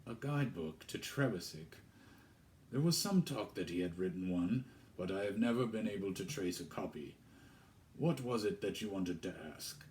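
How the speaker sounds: lightly voiced vicar. Vicar replies to girl.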